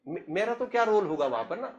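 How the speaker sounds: usual speaking voice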